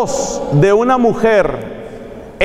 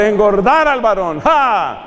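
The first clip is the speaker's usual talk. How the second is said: ordinary delivery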